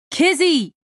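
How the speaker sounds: high shout of her name; calling out loud. A narrator's reading. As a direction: yell her name over the music. Shouting over loud music.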